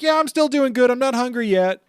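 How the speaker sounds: very high, loud goofy Luffy. Retold or imitated. Recapping anime, voicing carefree Luffy. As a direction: chirp it like cheerful Luffy. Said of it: "And he's like".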